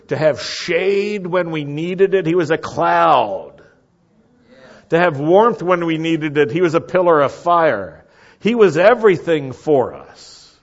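loud, high, building testimony; impassioned pulpit crescendo; fervent preaching. Real, not acted. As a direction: proclaim it with rising force, drawing out the key words.

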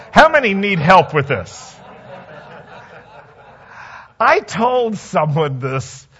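ordinary talk

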